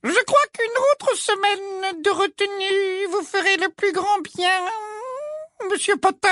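very high, slow syrupy teacher; slow, high, unctuous menace. A narrator's reading. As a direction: drawl it out high and oily-sweet. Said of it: "dit Ombrage d'une voix onctueuse".

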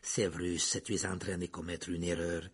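plain reading voice

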